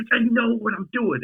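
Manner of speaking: high retold protest; mock exasperated retort. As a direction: act out shushing a pushy friend. Retold bickering with the friend.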